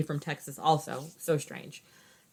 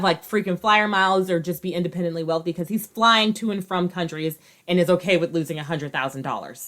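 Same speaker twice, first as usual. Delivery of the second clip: ordinary talk, nothing marked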